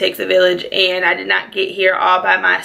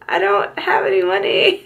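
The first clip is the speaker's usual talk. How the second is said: high, laughing half-cry; laughing confession; 11 semitones higher than usual. Retold or imitated. To friends wanting to party, a high laughing half-cry. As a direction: laugh it out, almost crying. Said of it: "And I'm like".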